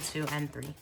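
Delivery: ordinary talk, nothing marked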